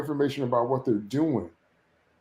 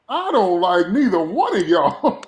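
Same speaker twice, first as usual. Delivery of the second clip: laughing dismissal; 5 semitones higher, 7 dB louder than usual. Retold or imitated. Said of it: "and I'm like".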